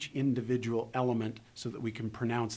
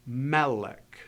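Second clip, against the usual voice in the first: "melech" with MEL raised and punched; stress on first syllable; 4 dB louder than usual. Shown as a demonstration. Teacher models an unexpected Hebrew accent, stress on MEL in "melech". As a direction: hit the start of "melech": MEL-ech, second syllable lighter. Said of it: "elevate your voice at the beginning of the word".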